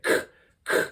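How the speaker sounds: hard k click for snare. Shown as a demonstration. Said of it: "a k sound".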